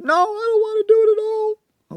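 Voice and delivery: put-on very high squeaky little-kid voice; child voice. Dad playing his own backtalking child, high pitched. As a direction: play the defiant child, high and whiny. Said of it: "I model it for her".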